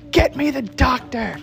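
high, slow, strained demand; drawn-out mock yell; mock screaming. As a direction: act out a patient's scream, held back in volume.

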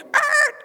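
high loud mock grunt; hammed-up kid's stalling sound; mock hesitation grunt. Imitating Ralphie stalling on the slide, mock grunt.

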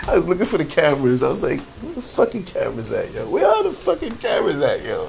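high, fast words through leftover laughter; laughing while looking around for cameras; still giggling. Real, not acted. Recounting the prank, still laughing high.

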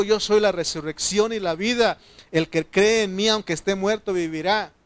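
high, ringing scripture quotation; forceful preacher declaring scripture; impassioned preaching. Acted.

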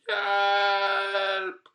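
long, high, loud cry for help; piercing drawn-out call; acted mad scream. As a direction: shriek it out in mock distress. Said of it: "en vanvittig skrik".

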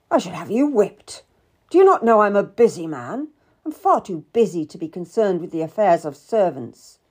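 loud, indignant scolding; cross. A narrator's reading. Busy lord rebukes Cat for her ruse, cross. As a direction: scold an impertinent servant. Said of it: "He looked at me crossly".

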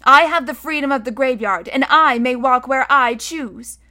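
measured, emphatic claim of a right; recited declaration. A narrator's reading. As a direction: recite it like a lesson learned by heart. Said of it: "he said as he had been taught".

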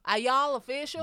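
exaggerated American twang; American accent. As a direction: belt it out in a put-on American accent. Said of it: "Your American, you can get arrested with that accent".

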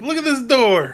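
hammed-up quote of the actor; mock impression. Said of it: "He goes".

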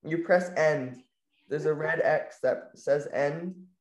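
slow, patient explaining; slow instructions. Real, not acted. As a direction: talk her through it slowly. Guiding grandma step by step.

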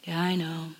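low, soft resigned inner voice. Retold or imitated. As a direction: mutter it back to yourself. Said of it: "voice in my head".

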